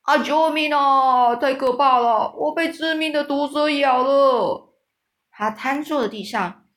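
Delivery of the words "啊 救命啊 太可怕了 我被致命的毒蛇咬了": cowardly giant howling that a deadly snake bit him; terrified cry for help. A narrator's reading. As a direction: scream for help as if dying. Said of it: "大声就很咆哮着说".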